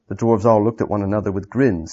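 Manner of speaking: plain reading voice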